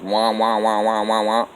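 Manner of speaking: lightly voiced muffled wah wah teacher noise; Charlie Brown teacher. Recalling a cartoon, imitating the teacher's wah wah. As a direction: mimic the droning teacher, mildly. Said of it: "the teacher goes".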